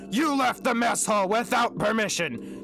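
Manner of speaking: high, loud authoritarian scolding; shouted. A narrator's reading. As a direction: shout the threat like a prison guard. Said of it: "marched forward and shouted".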